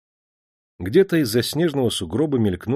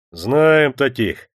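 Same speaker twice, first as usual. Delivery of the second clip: slow, loud, embittered retort; bitter guard's yell; spiteful shout; 3 semitones higher, 5 dB louder, much slower than usual. A narrator's reading. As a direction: throw it back with malice, drawn out. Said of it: "озлобленно закричал бородатый страж".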